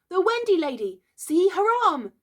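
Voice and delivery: high, loud, urgent pointing-out; amazed cry. A narrator's reading. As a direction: alert everyone to the marvel. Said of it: "he cried".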